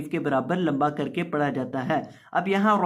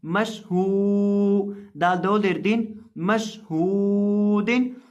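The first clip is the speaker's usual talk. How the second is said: slow chanted spelling, each syllable drawn out; Quranic spelling recitation; 3 semitones higher, much slower than usual. Shown as a demonstration. (धीमी तिलावत में हिज्जे)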